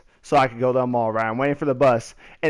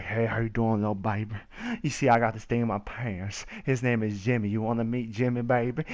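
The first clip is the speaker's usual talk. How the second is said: low, quiet creepy come-on; creepy homeless guy; 5 semitones lower, 8 dB quieter than usual. Retold or imitated. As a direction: leer at her in a hushed voice. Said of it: "freaking homeless dudes like".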